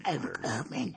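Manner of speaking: low gravelly Batman growl; mock Batman, very low and soft. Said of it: "the whole I'm Batman thing".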